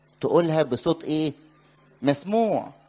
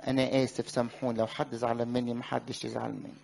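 mocking routine sorry, lower, fading much softer; rote church apology; 5 semitones lower, 7 dB quieter than usual. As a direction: mumble the stock formula away to almost nothing. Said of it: "دي لغتها بقى في الكنيسة".

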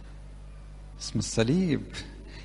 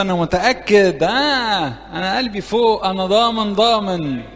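higher, louder, puffed-up boasting to God; mock overconfident prayer; 5 semitones higher, 4 dB louder, slower than usual. Priest mimicking a cocky believer at prayer, smug and loud. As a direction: play the smug worshipper sure his heart is already above. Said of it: "مش هقول له".

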